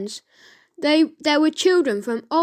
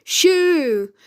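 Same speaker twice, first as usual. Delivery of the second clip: loud high shoo; bawl; 4 semitones higher, 5 dB louder than usual. Acted. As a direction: chase it away yelling. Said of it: "bawled Edmund".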